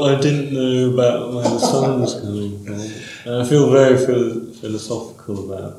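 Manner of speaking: put-on ponderous thinker; hammed-up philosopher's voice; mock philosophical musing. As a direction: muse aloud, trailing down softly. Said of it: "sort of quite philosophical about things".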